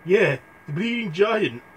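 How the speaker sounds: a shifted goose voice; put-on voice take. Repeated line, different take.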